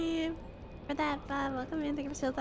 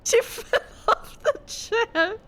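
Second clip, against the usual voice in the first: real slow, high, laugh-choked words; helpless laughter; 6 semitones higher, slower than usual. Real, not acted. Owner retells the cat falling off the chair, laughing. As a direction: squeeze the words out between giggles.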